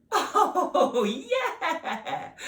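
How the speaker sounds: high, loud, laughing cheer. Acted. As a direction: laugh with delight. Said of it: "laughed Miss Potts".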